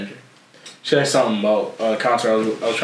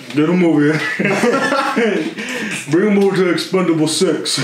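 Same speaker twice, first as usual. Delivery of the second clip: Stallone voice; 6 semitones higher than usual. Retold or imitated. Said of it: "Sylvester Stallone impersonator".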